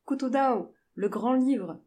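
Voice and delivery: high, loud attempt at getting across; trying to be understood. A narrator's reading. Jane tries to tell the porters her destination, calling out. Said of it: "tenta-t-elle de mimer".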